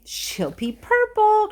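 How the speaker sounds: high, slow, drawn-out cry; cried out. Acted. Mister Wonka crying out to Charlie, high and stretched. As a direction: sing it out with delight. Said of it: "cried Mister Wonka".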